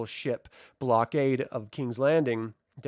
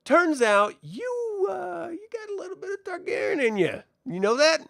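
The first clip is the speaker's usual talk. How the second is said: mock Rhaenyra voice. Rhaenyra teasing the knight, in a much higher mock voice. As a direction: tease him in a high sing-song. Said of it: "she's like".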